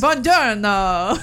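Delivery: slow, hammed-up Italian accent; mock Italian greeting. Imitating Russell Crowe's Italian accent on a Vespa. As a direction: stretch it out like a fake Italian. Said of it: "giant Australian man on a tiny Vespa going".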